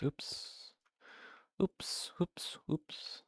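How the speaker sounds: soft whispered oops. Real, not acted. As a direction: whisper it under your breath. Whispered oops after a mistake.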